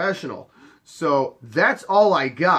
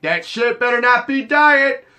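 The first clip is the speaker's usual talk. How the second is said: higher, louder, slow warning; threatening; 10 semitones higher, 7 dB louder than usual. Retold or imitated. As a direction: draw it out like a bouncer's threat. Threatening guy with bottle.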